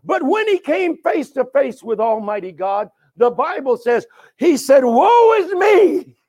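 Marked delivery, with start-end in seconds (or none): 4.8-6.2 loud, high, drawn-out wail of dismay; anguished prophetic cry. Acted. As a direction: cry out slowly as the overwhelmed prophet. Acted cry of the awestruck prophet.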